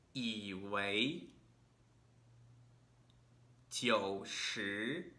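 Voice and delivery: low third tone, then rising second tone; tone drill. Shown as a demonstration. Teacher models third-second tone pairs for learners, careful drill. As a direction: keep the first syllable low, slide the second one up. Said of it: "Third tone is staying low".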